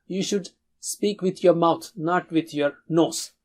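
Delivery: ordinary delivery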